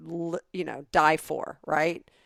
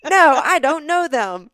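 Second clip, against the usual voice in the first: playful denial; 7 semitones higher, 9 dB louder than usual. Pretend. Joking role as denying friend.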